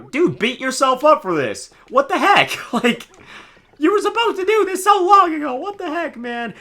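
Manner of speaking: high, loud mock outrage; indignant yelling. Real, not acted. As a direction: scold the character at full volume. Streamer indignant at game character.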